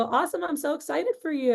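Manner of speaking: thrilled for her, voice lifting high; excited. Retold or imitated. Rep thrilled as client agrees to buy, excited. Rep reacts to surprise sale.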